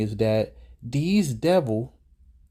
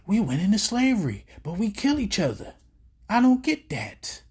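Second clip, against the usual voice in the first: mock quote; 8 semitones higher than usual. Mocking the man in the video who doesn't get it, high mock voice. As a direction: sneer at the caller's confusion in a put-on voice.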